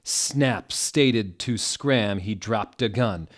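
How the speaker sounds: ordinary talk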